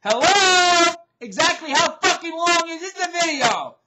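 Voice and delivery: loud, high-pitched incredulous call; exasperated yelling. Real, not acted. Vlogger challenging YouTube over time limits, exasperated and yelling. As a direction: holler the question in disbelief.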